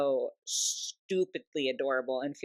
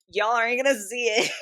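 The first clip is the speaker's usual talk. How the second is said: laughing; 6 semitones higher, 6 dB louder than usual. Real, not acted. Laughing at own mistake.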